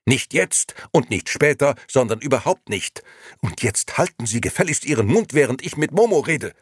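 loud, high, emphatic refusal; angry outburst. A narrator's reading. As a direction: tell them off furiously. Gigi angrily silences the lady.

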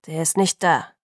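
lightly voiced menace; menacing. A narrator's reading. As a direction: warn off the caller, low and menacing. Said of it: "es klang drohend".

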